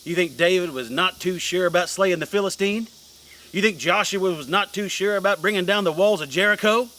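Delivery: preaching. Acted. Preacher rousing mob.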